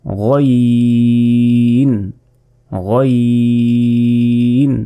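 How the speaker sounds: গইন held long from the back of the throat; গইন টেনে গলার ভিতর থেকে; Arabic ghayn, drawn out. Shown as a demonstration. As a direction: stretch গইন with a gargled throat sound. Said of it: "এটা পড়ার সময় বলতে হবে".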